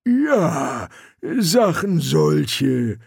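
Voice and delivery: slow, ponderous troll; lumbering troll agreement. A narrator's reading. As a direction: confirm it as a troll, dragging the words. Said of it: "bestätigte der Troll".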